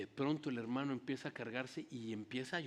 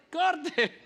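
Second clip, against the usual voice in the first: shout; 13 semitones higher, 8 dB louder than usual. Retold or imitated. Director yells cut again.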